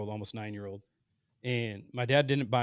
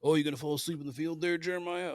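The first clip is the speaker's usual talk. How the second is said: mocking ribbing, pitched higher with a laugh; teasing hunting buddies; 6 semitones higher than usual. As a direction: imitate the guys needling him, laughing. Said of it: "still makes fun of me".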